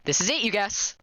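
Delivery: ordinary talk, nothing marked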